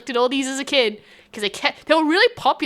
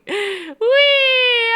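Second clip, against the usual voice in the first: high joyful squeal; excited whee; 11 semitones higher, 4 dB louder than usual. Real, not acted. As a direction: squeal with glee mid-jump. Jumping on the bed.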